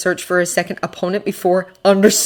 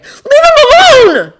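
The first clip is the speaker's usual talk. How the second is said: drawn-out, very high loud scream; long, frantic shriek; desperate scream; 10 dB louder, slower than usual. A narrator's reading. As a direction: scream to protect a friend. Boy screams to defend friend.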